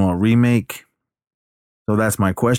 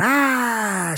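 imitated scream. Recapping her attempt to run out, mock scream. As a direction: hold a thin high movie scream. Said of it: "she screamed".

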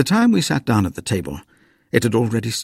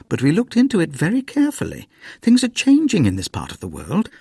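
higher, quick earnest mother; 7 semitones higher than usual. A narrator's reading. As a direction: defend the plan brightly. Mother voiced.

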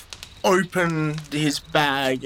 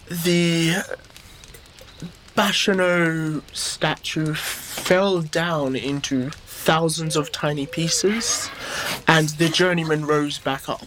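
slow, weighty, each word set down; slow solemn telling; slower than usual. Acted. Charlie telling Baptiste a legend, slow and solemn.

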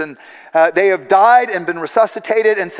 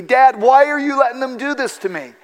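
put-on high, louder desperate plea of a frightened little girl; voicing a terrified daughter's plea, higher and louder; pleading child; 7 semitones higher, 3 dB louder than usual. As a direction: beg like a scared child being held down. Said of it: "as she pleaded with me".

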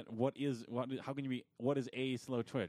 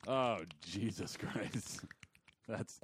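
face noises; much slower than usual. Pretend. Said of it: "Here it comes".

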